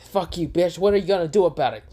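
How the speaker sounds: high, fast angry taunt. Acted. Iten taunting Kurama after getting angry, heated. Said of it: "Iten gets angry and says".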